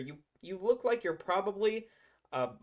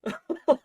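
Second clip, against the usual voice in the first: loud, high mocking laugh; burst of laughter; 9 semitones higher, 9 dB louder than usual. Real, not acted. Laughing at the clip.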